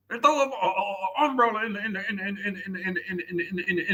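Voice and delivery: high put-on voice stuck repeating; lightly voiced glitching loop; mock stammer.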